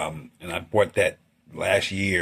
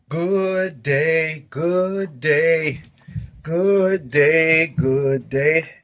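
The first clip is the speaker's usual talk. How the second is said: higher, lightly put-on sing-song good day; sing-song greeting; 6 semitones higher, much slower than usual. Artist greeting his viewers with a playful sing-song good day.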